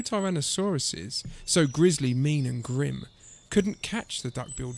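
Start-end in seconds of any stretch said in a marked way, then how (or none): none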